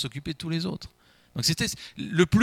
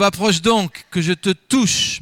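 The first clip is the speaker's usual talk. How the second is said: louder, drawn-out call to come closer; 3 semitones higher, 6 dB louder, slower than usual. Acted. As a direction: summon the son near, ringing and slow. Blind Isaac calls son closer.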